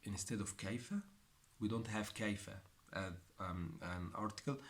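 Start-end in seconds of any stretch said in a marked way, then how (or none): none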